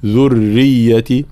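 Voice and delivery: slow "ذرية", doubled thin r and y; light doubled ra. Shown as a demonstration. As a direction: hold both doublings in "ذرية". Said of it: "مع ترقيق الراء وبيان التشديد". (ذرية بترقيق الراء وبيان التشديد)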